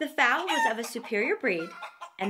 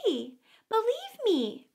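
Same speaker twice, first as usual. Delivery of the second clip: high, pleading lamb voice; 10 semitones higher than usual. A narrator's reading. Lamb protesting to the wolf, high and pleading. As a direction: insist innocently, small and high.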